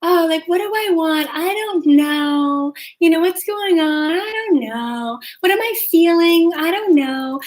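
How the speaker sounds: lightly mocking ditzy feminine musing; dainty feminine daydreamer. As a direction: play the dreamy dainty girl who knows nothing. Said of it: "such a feminine thing to do".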